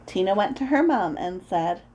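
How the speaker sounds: usual speaking voice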